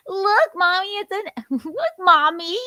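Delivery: put-on high, loud little-kid voice calling out; baby talk. Grown daughter in baby voice showing mommy a toy.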